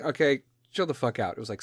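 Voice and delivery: usual speaking voice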